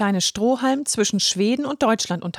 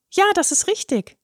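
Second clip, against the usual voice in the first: a higher, earnest little voice; the shy straw explaining himself; young straw Halme; 9 semitones higher than usual. A narrator's reading. Halme answers.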